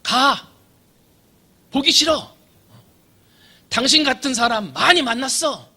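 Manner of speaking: put-on furious young prisoner yelling, high and loud; condemned young man shouting at the governor in rage; angry shout. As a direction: bark him away from the cell bars. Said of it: "성난 목소리로 고함을 질러요".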